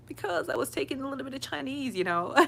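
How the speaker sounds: high, quick, pleased reply; playfully proud. Retold or imitated. Telling impressed clients why she pronounces names well, high playful pride. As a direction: toss it off proudly, breaking into a laugh at the end. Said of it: "I felt so proud".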